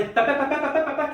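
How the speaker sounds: rapid repeated band noises, rising in pitch; imitated wedding band. Groom's friend imitating the band before a wedding, mock tune. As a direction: mimic the band's snake-charmer tune. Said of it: "नागिन बीन क्यों बजाते हैं".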